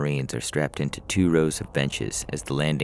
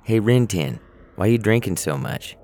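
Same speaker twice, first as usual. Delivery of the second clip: louder call down the row, higher; 7 semitones higher, 5 dB louder than usual. Acted. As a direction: shout it down the bench. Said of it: "Someone down the row calls out".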